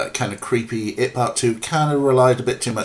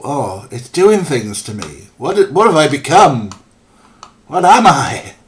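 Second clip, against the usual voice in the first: loud, higher hammed-up swoon; melodramatic swooning; 6 semitones higher, 9 dB louder than usual. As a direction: overwrought melodrama, getting louder. Acts lusty overwhelmed character.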